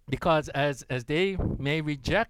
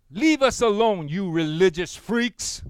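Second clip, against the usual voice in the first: loud, higher jeer slowing at the end; angry yell; 4 semitones higher, 6 dB louder than usual. Retold or imitated. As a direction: yell it out the car window. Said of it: "this person yelled to them".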